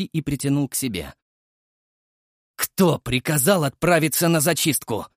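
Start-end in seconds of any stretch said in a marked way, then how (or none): 2.5-5.1 loud, higher angry growl. Acted. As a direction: growl the demand at him. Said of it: "прорычал я". (рычание в гневе)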